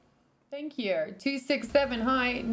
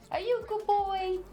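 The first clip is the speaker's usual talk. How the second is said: much higher cooing puppy voice; 10 semitones higher than usual. Real, not acted. Owner cooing at her dog, very high baby talk. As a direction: really coo it at the dog, way up high.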